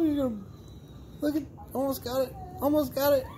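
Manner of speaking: put-on high sing-song coaxing to a baby; baby talk. Mother cheering baby girl trying to stand, in high baby talk.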